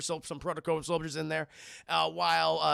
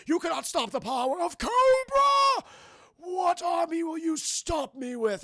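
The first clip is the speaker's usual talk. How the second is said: hammy villain; 9 semitones higher, 3 dB louder than usual. Retold or imitated. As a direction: proclaim it like a cartoon overlord. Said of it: "And he's like".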